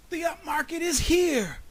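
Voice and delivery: high, loud, strained cry; straining shout. A narrator's reading. Groundhog still spreading the news, straining shout. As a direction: shout as if the voice is wearing out. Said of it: "kept shouting in a voice that was becoming rather hoarse".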